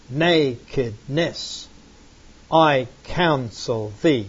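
slow, measured, lifting the ends; "Nakedness, I counsel thee" read slowly to show the rhythm; rhythmic reading. Shown as a demonstration. Teacher reads scripture slowly to show its rhythm. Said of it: "how the stress is going up on the ends".

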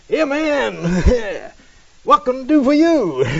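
high, chuckling Rastafarian greeting; Rastafarian at the door. Acted. Rastafarian opens the door to missionaries, laughing greeting. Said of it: "to the door comes this guy".

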